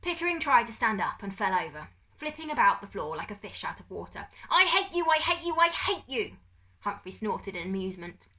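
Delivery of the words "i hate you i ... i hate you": high, loud screaming tantrum. A narrator's reading. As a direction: scream it like a furious child. Pickering flailing in tantrum.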